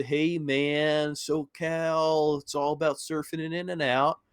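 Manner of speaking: laid-back surfer dude voice; SoCal surfer accent. Retold or imitated. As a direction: drawl it like a California surfer. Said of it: "of Slime".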